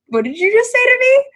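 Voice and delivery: high, loud incredulous squeal; can't believe your ears, shoot way up high; retold disbelief. Bar story, reacting to friend's field passes, incredulous.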